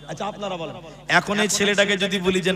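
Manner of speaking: ordinary talk, nothing marked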